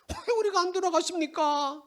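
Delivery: high, loud indignant question; people protesting. Acted. The people protesting to God, high and loud. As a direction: push back, offended. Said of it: "하나님".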